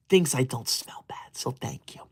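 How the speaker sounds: a secret whispered aside to chat; hushed whisper tacked onto the end. Acted. Aside about teacher, whispered.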